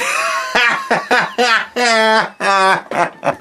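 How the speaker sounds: a long breathless laugh, losing it; cracking up. Real, not acted. Streamer cracking up at his own taunt, laughing. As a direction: dissolve into giggling laughter.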